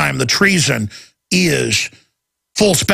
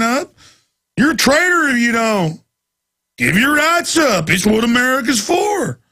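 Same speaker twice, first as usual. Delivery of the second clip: lightly raised mocking voice; sarcastic mockery; 7 semitones higher than usual. Host sneering at blind patriots, mocking tone.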